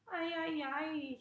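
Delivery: acted cry of woe; mock wailing. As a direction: wail lightly like a hurt little boy. Boy crying after being knocked down.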